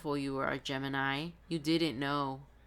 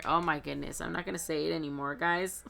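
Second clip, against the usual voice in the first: ordinary delivery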